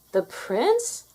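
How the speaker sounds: high rising disbelief; stunned. A narrator's reading. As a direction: gasp the question in shock. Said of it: "Cinderella was stunned".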